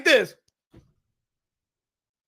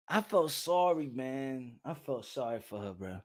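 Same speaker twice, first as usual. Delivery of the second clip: usual speaking voice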